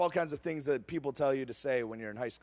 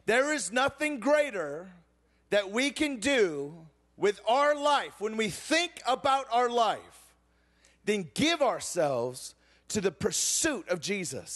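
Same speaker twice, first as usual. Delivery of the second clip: preaching; 3 semitones higher, slower than usual. Acted. Preaching point after story.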